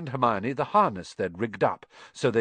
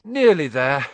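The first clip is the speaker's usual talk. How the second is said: louder, breathless pant; 5 semitones higher, 8 dB louder than usual. A narrator's reading. Harry out of breath from carrying the crate, panting. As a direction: gasp it out after the climb. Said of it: "Harry panted".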